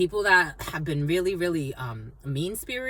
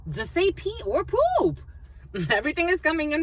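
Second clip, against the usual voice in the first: exasperated; 9 semitones higher, 3 dB louder than usual. Real, not acted. Exasperated about potty words.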